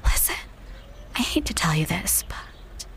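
whispered confidence; conspiratorial whisper. Acted. Fiancée confiding about Noah, a conspiratorial whisper. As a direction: lean in and share a secret.

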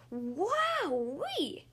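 high, drawn-out excited whoop; excited squeal. A narrator's reading. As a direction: burst in with childlike delight. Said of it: "I screamed excitedly".

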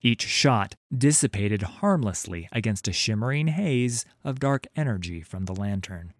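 ordinary delivery, nothing marked

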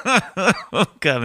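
high burst of real laughter; cracking up at his own joke. Real, not acted. Podcaster laughing at his own accent bit, real laughter. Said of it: "made myself laugh".

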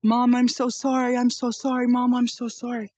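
imitating a daughter begging sorry; daughter's tearful apology. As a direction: beg forgiveness like a distraught daughter. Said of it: "and she's like".

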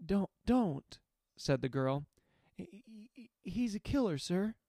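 put-on high, anxious pleading girl; frightened girl. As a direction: beg him not to go, scared.

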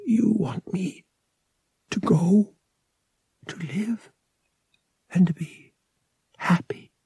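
broken half-whisper, breaking between words; barely getting words out. A narrator's reading. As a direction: choke the words out through tears. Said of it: "barely able to get the words out".

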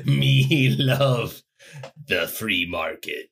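lightly voiced caveman, slow ending; slow dumb caveman grunt. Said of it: "caveman voice".

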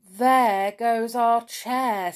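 slow, drawn-out lament; slow, mildly woeful exclamation; sorrowful. A narrator's reading. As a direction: mourn it with a touch of sorrow. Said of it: "said Binky sorrowfully".

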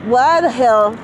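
hammed-up disbelief; exaggerated outrage. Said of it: "I'm exaggerating".

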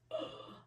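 sharp breathless gasp of shock; a quick, voiceless gasp; retold gasp. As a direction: suck in air like your jaw just dropped. Retold moment of speechless shock.

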